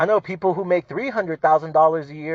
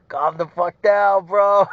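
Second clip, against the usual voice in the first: slow, drawn-out exasperated scolding; exasperated calm down; 5 semitones higher, 4 dB louder, slower than usual. Retold or imitated. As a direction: drag it out like telling off a show-off. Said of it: "and it's like".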